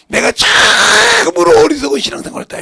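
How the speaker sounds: loud, high testimony cry; impassioned preacher voicing repentance; anguished confession. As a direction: shout it out in heartfelt regret. Acts out a believer's testimony.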